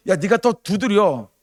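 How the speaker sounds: high, loud, slow urging. Retold or imitated. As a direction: drive them to knock harder. Voicing God urging again. (더 두드리라고 높고 크게 재촉)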